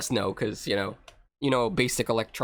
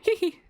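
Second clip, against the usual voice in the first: very high-pitched "hee hee"; mad giggle. Pretend. Player jokes about going insane, mad giggle. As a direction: play a tiny crazed titter.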